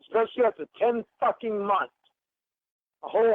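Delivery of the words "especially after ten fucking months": ordinary delivery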